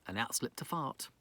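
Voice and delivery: whispered punchline; cheeky whisper; hushed aside. Acted. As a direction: drop to a secret whisper. Comic hushed line in story.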